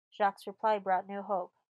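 ordinary delivery, nothing marked

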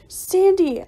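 high, loud startled call; alarmed cry. A narrator's reading. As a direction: cry out a friend's name in alarm. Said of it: "I cried".

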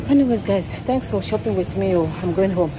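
ordinary talk, nothing marked

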